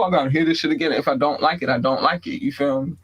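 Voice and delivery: ordinary talk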